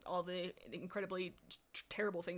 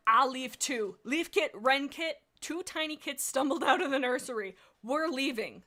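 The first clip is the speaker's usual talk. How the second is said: earnest resolve; 4 semitones higher, 7 dB louder than usual. Acted. Kits leaving, dramatic.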